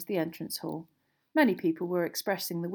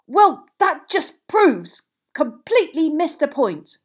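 loud, high, slow indignant outburst; thunderstruck spluttering; 9 semitones higher, 14 dB louder, slower than usual. A narrator's reading. Ron protests to Harry after the row, thunderstruck spluttering. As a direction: splutter it out, outraged and disbelieving. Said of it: "he spluttered, looking thunderstruck".

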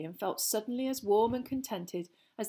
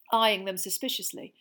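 plain reading voice

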